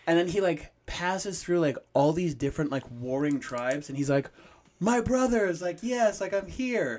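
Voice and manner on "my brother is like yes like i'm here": mock-heroic call, pitched high; exuberant greeting. Film hero hailing the warring tribes, exuberant. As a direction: play up a jubilant hero's hail.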